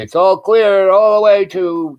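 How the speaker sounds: high, loud, drawn-out all-clear; calling out. Retold or imitated. Retold all-clear called out.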